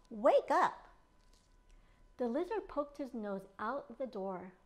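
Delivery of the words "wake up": loud wake-up call. Acted. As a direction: shout Wake up to rouse a sleeper. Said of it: "Haribon called".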